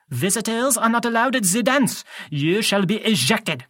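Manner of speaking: put-on high, stern French-accented voice. A narrator's reading. French-accented teacher throwing out intruders, snapped. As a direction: order them out in a French accent. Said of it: "He had an accent, uh, French maybe?".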